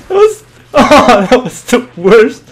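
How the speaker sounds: high, loud, breaking into laughter; laughing dismay. Real, not acted. Gamer mocks his own failed exchange, laughing loudly. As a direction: laugh helplessly through the words.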